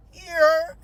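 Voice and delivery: high, loud tearful blurt; hammed-up crying as you hand it over; mock sobbing. Sobbing driver hands papers to cop, mock crying. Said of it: "I'm, like, sobbing and heaving".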